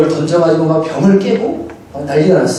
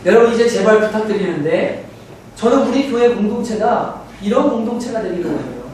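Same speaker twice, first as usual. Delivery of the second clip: usual speaking voice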